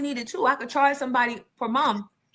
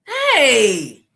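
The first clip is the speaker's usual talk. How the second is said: teasing shout; 10 semitones higher, 10 dB louder than usual. Real, not acted. Playful loud jab.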